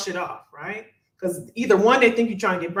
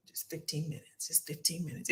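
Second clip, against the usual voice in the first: soft aside; 4 semitones lower, 17 dB quieter than usual. Real, not acted. Coaching, soft emphasis.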